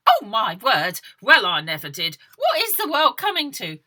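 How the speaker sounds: put-on shocked old biddies, loud and high; loud, high tutting old woman; fussy old women. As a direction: clutch your pearls like a scandalised old lady. Nosy old women voiced.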